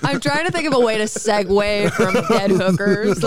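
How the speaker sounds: words through laughter; laughing. Real, not acted. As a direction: laugh while you say it. Laughing while talking.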